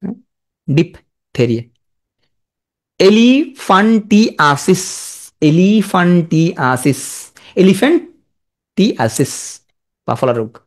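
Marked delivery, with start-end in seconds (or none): 0.7-1.6 slow ডিপথেরিয়া as "dip ... theria"; split for spelling. Shown as a demonstration. Teacher noting the ph, slow split ডিপথেরিয়া. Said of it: "পিএইচ আছে". 3.0-9.3 এলিফ্যান্টিয়াসিস slowly as e-li-fan-ti-a-sis; spelling pronunciation. Shown as a demonstration. Teacher sounding out a long word, slow chunked এলিফ্যান্টিয়াসিস. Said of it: "আমি উচ্চারণ করে লেখো".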